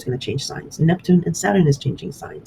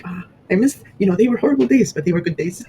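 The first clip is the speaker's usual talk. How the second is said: lightly acted, higher wistful sigh; mock nostalgia; 5 semitones higher than usual. Looking back on horrible but good days, fondly nostalgic. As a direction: look back fondly, pitched up.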